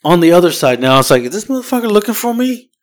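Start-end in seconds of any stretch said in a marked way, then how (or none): 1.2-2.7 much higher, incredulous and rattled; alarmed disbelief. Retold or imitated. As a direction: voice jumps up in alarmed surprise. Drunk truck makes U-turn.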